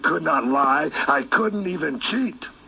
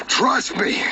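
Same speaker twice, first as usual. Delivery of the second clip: high, teasing assurance; 9 semitones higher than usual. Acted. Villain's creepy assurance, first take.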